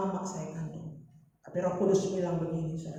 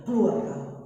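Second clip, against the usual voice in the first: imitated angry parent, briefly louder; mock-stern, louder command; retold angry dismissal; 3 semitones higher, 7 dB louder than usual. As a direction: throw out a mildly acted angry order. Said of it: "dia marah".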